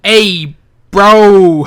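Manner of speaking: loud, mock New York "bro!" shout. Mocking the wrestler's New York greeting, loud New York accent. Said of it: "a New York accent".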